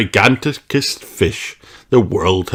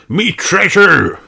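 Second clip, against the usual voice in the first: slow, high, loud wail for lost treasure; pirate's cry; 11 semitones higher, 4 dB louder than usual. Acted. Redbeard cries out as his treasure sinks. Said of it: "he cries".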